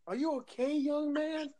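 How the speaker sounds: high, hammed-up concerned stranger; mock dramatic concern. Brother teasing Shane about his crash, hammed-up concern. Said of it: "Make it more dramatic".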